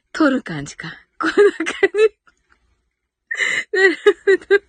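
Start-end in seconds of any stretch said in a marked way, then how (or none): 3.7-4.6 laughing. Real, not acted. Still laughing at a comment.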